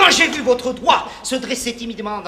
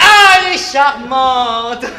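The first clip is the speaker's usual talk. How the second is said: gushing delight; 2 semitones higher, 9 dB louder, slower than usual. Acted. Charlatan doctor gushing over patient.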